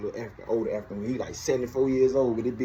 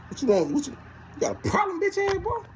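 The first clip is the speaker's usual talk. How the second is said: sharply higher, rising challenge; mock confrontation. Confronting the cellmate who stared, high rising challenge. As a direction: get in his face. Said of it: "confronting the staring cellmate".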